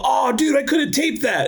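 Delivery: high excited shout. Retold or imitated. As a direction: yell it, thrilled to hear yourself on the radio. Excited about hearing himself on air.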